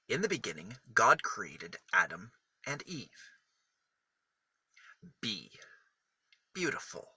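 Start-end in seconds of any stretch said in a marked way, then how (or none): none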